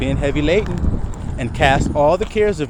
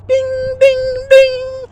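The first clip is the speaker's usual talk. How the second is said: high bell-like dings, rising louder; cartoon ding; 7 dB louder, much slower than usual. Cartoon bell over the knocked-out lion's head, high ringing dings.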